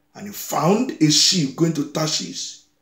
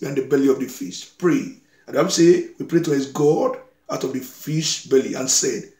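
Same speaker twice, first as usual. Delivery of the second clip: normal talking voice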